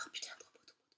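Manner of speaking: breathless whisper of awe; hushed, amazed whisper to yourself; whispered gasp. Retold or imitated. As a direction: whisper it like you can't believe how pretty it is. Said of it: "j'ai fait".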